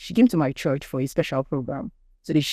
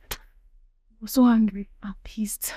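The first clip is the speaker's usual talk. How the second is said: hushed, breathy admission; whispered anger; 6 semitones higher than usual. Real, not acted. Telling the listener her rage, whispered. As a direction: let the anger come out in a whisper. Recalling rage on suicide day.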